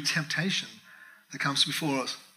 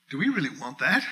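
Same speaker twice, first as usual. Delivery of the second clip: usual speaking voice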